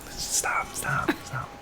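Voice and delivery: urgent whispered stop; whispering. Retold or imitated. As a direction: hush them in a quick whisper. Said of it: "Guillermo's like".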